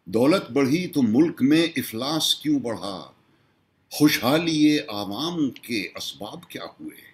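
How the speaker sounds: projected mushaira-style recital; poem recitation. Acted. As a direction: put each question to the audience in verse.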